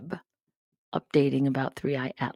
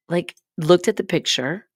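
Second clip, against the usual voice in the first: ordinary talk, nothing marked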